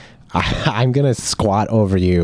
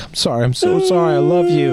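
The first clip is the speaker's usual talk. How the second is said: shrill, much higher gushing father apology; mocking the father. Father bursting through the police line, shrill gushing apology. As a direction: squeal it out like an overwrought dad.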